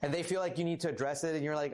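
ordinary talk

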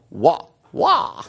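hop between pitches on each "wa"; playful tonal "wa" variations; tone demonstration. Shown as a demonstration. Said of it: "all the different ways you could say".

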